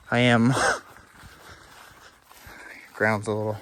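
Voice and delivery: out of breath, laughing; breathless laugh while climbing; gasping for air. Real, not acted. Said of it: "Sorry, I sound like I'm out of breath".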